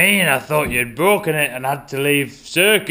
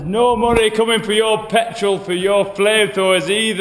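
angry raised voice; 9 semitones higher than usual. Acted. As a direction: shut him down hard. Circus boss cutting off money.

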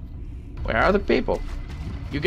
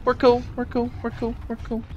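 fading reassurance; 5 semitones higher, 6 dB quieter than usual. Real, not acted. Placating animal, backing off.

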